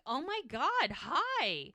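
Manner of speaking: high, swooping happy surprise; delighted greeting. Retold or imitated. Mel meets Kaya in person, imitated high delighted greeting.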